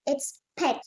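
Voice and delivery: "peck" with a clear short e, like "deck"; correct vowel. Shown as a demonstration. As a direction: say "peck", not "pick". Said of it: "It's not pick".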